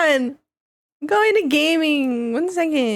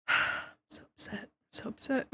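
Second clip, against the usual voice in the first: breathy unvoiced whisper; 12 dB quieter than usual. Whispered complaint.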